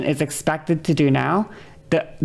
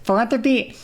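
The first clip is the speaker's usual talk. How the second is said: demonstrative yelling; 6 semitones higher than usual. Real, not acted. Streamer letting listeners hear them yell at chatters, worked up. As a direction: launch into a raised-pitch telling-off. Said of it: "You wanna hear me yell at them?".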